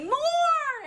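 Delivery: high, loud eager shout; excited cry. A narrator's reading. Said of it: "everyone cried".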